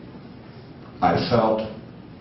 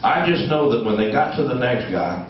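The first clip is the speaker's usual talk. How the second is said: ordinary talk, nothing marked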